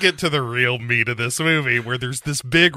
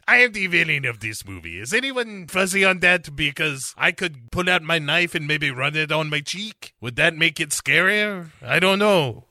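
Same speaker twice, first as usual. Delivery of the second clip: hammed-up villain in a mixed foreign accent; higher, cartoonish Spanish-French accented baddie; Jon Voight's muddled accent; 4 semitones higher than usual. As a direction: brazenly announce villainy in a mongrel accent. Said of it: "it's just clear that he is announcing".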